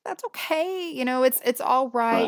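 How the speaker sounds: put-on high, tender reassurance to a child; mother calming her guilty daughter, high and tender; reassuring mother. Mother comforting upset daughter.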